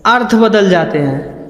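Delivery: ordinary talk, nothing marked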